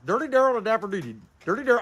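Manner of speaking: tongue-twister speed run; tongue twister said fast. Shown as a demonstration. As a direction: rattle the name off at speed. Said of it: "Say that ten times real fast".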